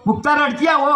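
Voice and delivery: high, called-out appeal to an elder; acted plea, pitched high; pleading cry. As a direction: cry out for help on your sister's behalf. Brother pleads with the villain.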